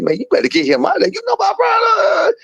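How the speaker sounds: high, fast, shouted warning; imitating a frantic warning cry; retold warning shout. Friend's warning, acted out.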